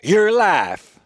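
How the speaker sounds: high, loud, drawn-out declaration; big dramatic movie line, broken by a pause; committed line reading. Shown as a demonstration. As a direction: belt it out with full commitment. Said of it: "I like the way I said it better".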